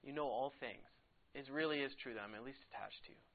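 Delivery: a touch of heartbreak, hushed; brokenhearted. Retold or imitated. Peter answers Jesus the third time, softly brokenhearted. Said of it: "Peter was brokenhearted".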